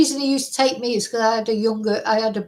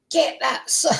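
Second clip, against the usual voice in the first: put-on higher-pitched, botched Scottish accent; hammed-up Scot, badly faked; attempted Scottish accent; 6 semitones higher than usual. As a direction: have a poor go at a Scottish accent. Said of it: "I can't do a Scottish a-, accent".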